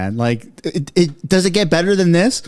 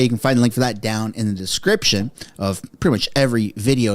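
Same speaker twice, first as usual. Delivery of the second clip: ordinary talk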